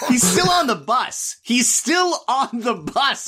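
high, emphatic repeat, slowing down; incredulous complaint. Real, not acted. Host chiding co-host over the slow recap, exasperated. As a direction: hammer it home in exasperated disbelief.

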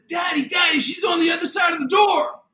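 put-on high, loud child's shout; imitating panicked daughter. Daughter at the door warns father, imitated panicked shout. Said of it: "She's freaking out".

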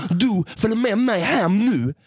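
high, insistent mock voice of a drunk stranger; pushy drunk woman. Friend mimicking the woman grabbing Sofia, high and insistent. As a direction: squeak it out like a clingy stranger.

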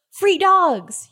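gleeful gasp. Pretend. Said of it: "so this is like".

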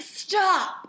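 sharp high shout of stop; retold shout. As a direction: bark it like a furious choreographer halting rehearsal. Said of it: "And he was like".

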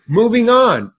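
high, loud call to move along; director calling out. Retold or imitated. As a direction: announce it to the whole crew. Imitating director calling to crew.

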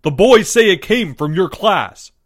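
high, loud, insistent; agitated accusation. A narrator's reading. Mister M blaming Mister L's class, agitated and loud. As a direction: press the accusation loudly.

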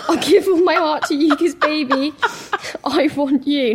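reading love lyrics while laughing; laughing, cringing while reading aloud; laughing through lyrics. Real, not acted. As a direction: giggle through the cheesy lines. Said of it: "I'm cringing out".

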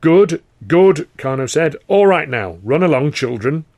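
loud patronizing dismissal; dismissive boss. Acted. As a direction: shoo them off like children. Carno dismisses them.

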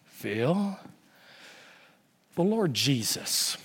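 low, soft, slow deep pastor voice; slow and deep over the phone; old friend pointing him to Jesus, deep and slow. Retold or imitated. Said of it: "I could hear him saying".

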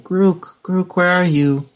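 calling out. Acted. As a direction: call out for someone lost in a crowd. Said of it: "calling out".

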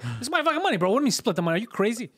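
high-pitched exasperated protest; retold rant, voice jumping up; indignant outburst. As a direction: argue back in disbelief. Retold indignant argument over his money.

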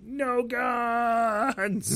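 high, stretched-out movie-hero yell; drawn-out mock cry. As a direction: cry it out long and high, hamming it up. Mimicking Takeda's cry in film.

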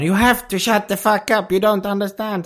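high-pitched mock fury, no real shout; imitated angry outburst. Retold comedian losing patience with chatting Americans, mock-angry high outburst. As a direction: scold in exasperation, pitched well up. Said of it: "då blir han skitsur".

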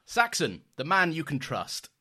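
high, loud slogan announcer; campaign slogan voice. Retold or imitated. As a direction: sell it like a campaign ad.

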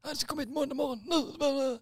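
high, loud mock-furious yell; hammed-up raging demand; imitated screaming. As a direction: shriek the order like an enraged customer. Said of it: "så han skrek och gapade".

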